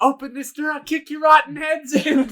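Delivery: high, loud mock threat; retold screaming. Scientist locked in with the monster, mock screaming. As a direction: shout it like a trapped man, hammed-up. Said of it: "He's, like, screaming".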